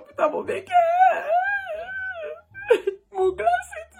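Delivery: very high, crying wail; a shrieking half-laughing cry; hysterical wail. Real, not acted. Wailing over her boyfriend.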